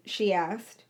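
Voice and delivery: plain reading voice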